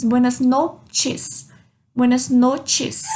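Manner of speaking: slow "noches" as "not-ches"; careful corrected "noches" with t sound. Shown as a demonstration. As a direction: stretch "noches", with a clear "tch". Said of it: "O C H forma o som do T".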